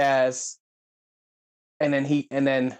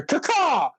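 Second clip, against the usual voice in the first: high, sharp bird cry; eagle caw; 12 semitones higher than usual. Retold or imitated. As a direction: screech like an eagle. Imitating the eagle call.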